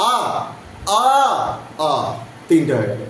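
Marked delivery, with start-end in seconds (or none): 0.1-1.9 each "আ" a separate sustained vowel; teacher modelling three central "আ" vowels; central vowel drill. Shown as a demonstration. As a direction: sound out "আ, আ, আ" with gaps between. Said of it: "তিনটে central vowel".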